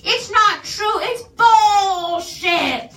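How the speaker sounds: overacted Tommy Wiseau denial shouted slowly; imitating Tommy Wiseau. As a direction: yell it in a hammed-up Tommy Wiseau accent. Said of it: "I'm just gonna try and copy his accent".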